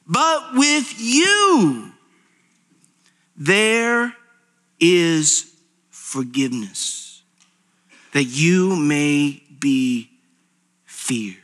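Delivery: slow reading that sinks into a whisper; solemn verse fading to a whisper; dramatic scripture reading. Acted. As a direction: let each word fall, ending hushed.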